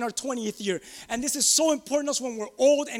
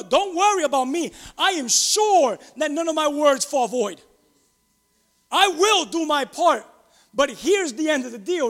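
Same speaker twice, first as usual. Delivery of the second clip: loud, rising sermon delivery; preaching at full force; 4 dB louder than usual. Acted. Pastor preaching God's promises to Joshua, full force. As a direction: preach it to the congregation.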